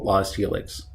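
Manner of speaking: usual speaking voice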